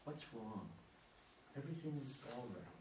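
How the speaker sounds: soft, hushed comforting; calm her like a crying child; tender soothing. Acted. Father soothing his sobbing daughter, softly. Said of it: "approaches her tenderly".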